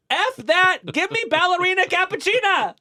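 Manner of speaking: high, loud voicing of fed-up fans; mock defiant demand. Colleague voicing people sick of Hollywood reruns, mock defiant demand. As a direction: cry it out like a crowd demanding something new.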